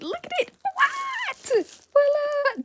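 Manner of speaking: voila shrieked way up high; big squealing ta-da reveal; excited reveal. Acted. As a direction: shopper shrieking over a new candle kit. Reveal of the kit.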